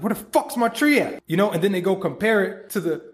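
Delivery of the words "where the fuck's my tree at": much higher, indignant squawk at the missing tree; mock outrage. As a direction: play the impatient gardener, outraged. Mocking impatient planter.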